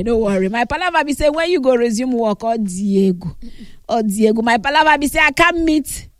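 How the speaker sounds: ordinary delivery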